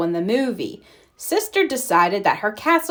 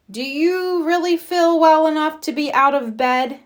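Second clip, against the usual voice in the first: lightly voiced Mama; 7 semitones higher than usual.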